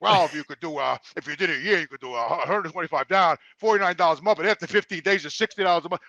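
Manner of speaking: low, quiet, mumbled salesman voice; imitating the salesman. Gym clerk listing prices to Jimmy, low and mumbling.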